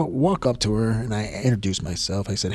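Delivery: ordinary delivery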